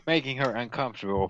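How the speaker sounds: usual speaking voice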